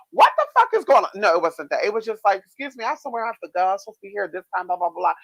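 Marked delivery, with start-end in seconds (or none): 0.1-1.1 much higher, louder and fast, an outraged yell; a loud exasperated yell of what the fuck; mock holler. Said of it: "not the type of bitch hollering that I would do". 2.4-5.1 fast, hurried excuse me; gabbling it out in a hurry; a polite but rushed airport complaint. Retold or imitated. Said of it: "So just a very rushy feeling".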